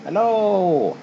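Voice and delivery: high, loud goofy hello; silly high hello. Pretend. Silly greeting.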